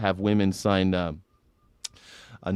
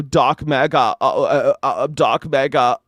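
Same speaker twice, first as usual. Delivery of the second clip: louder, stammering mock Elon; Elon Musk impression; 2 semitones higher, 5 dB louder than usual. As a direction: stutter the name like Musk, hammed-up. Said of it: "he kind of talks like this".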